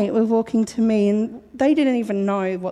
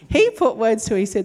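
ordinary talk

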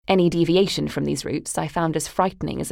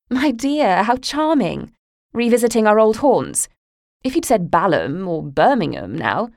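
much higher, louder, theatrically condescending; mocking mother; 5 semitones higher, 4 dB louder than usual. Mother belittling her daughter's trip to Tuscany, condescending. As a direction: gush with false charm to belittle her.

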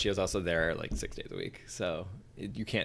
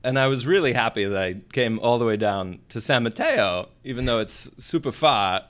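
Chris Walken impression; 6 dB louder than usual. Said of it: "I used to do a pretty good Chris Walken".

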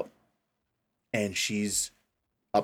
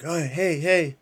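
put-on cheery old man; 6 semitones higher than usual. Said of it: "He's like".

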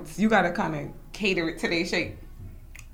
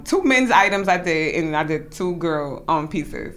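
usual speaking voice

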